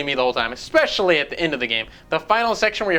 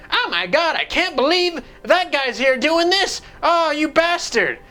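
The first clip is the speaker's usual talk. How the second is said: high, slow melodramatic shock; mocking cutscene characters; 9 semitones higher, slower than usual. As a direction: ham up the gasping characters.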